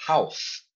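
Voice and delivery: "House" in one syllable with a voiceless final "s"; correct house. Shown as a demonstration. Teacher repeating for the student, correct one-syllable "House". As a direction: demonstrate "House" without any added ending. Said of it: "é assim que fala casa em inglês".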